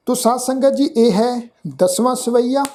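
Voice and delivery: ordinary talk, nothing marked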